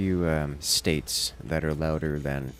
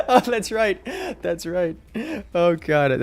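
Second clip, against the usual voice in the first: high, loud laughing remembrance; 9 dB louder than usual. Real, not acted. Streamer recalling a chat reply, laughing hard. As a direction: laugh it out as it comes back.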